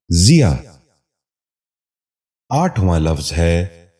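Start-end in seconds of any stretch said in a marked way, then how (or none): 0.1-0.6 ضیا starting with "zi", "zi-yaa"; correct pronunciation. Shown as a demonstration. Teacher giving viewers the model, correct pronunciation. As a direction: say ضیا as ziyaa. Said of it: "غور سے سنیے".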